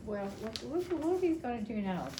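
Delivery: usual speaking voice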